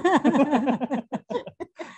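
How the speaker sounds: loud, high burst of laughter; big amused laugh; real hearty laugh. Real, not acted. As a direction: laugh out loud after your own joke. Host laughs at own joke.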